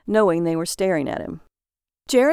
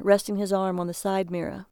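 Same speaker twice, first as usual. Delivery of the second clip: ordinary delivery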